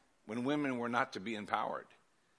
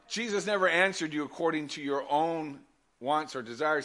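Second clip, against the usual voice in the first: ordinary delivery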